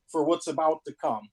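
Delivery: ordinary talk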